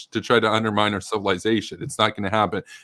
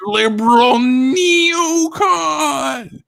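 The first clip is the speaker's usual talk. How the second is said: much higher and louder put-on voice; mock outraged insult; 4 dB louder, slower than usual. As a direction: sneer it like an offended critic. Mocking June's insult.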